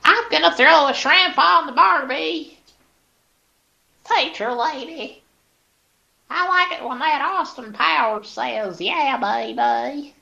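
squeaky annoying coworker caricature; annoying lady character. Pretend. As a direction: play the grating office lady. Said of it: "that character that I do".

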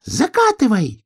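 high-pitched squirrel cry; urgent little squirrel yell; shouted order. A narrator's reading. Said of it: "крикнула белка".

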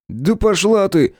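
high, drawn-out angry retort; snapped retort. A narrator's reading. Man answering a rat that insulted him, angry snapped retort. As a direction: fire back, pitch jumping up and words stretched. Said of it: "рявкнул я в ответ".

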